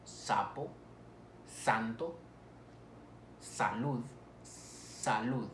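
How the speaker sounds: sapo, santo, salud, each opened on a held S; lengthened S. Shown as a demonstration. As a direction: model words spaced out, long S in sapo, santo, salud. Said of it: "tenemos la S de sapo".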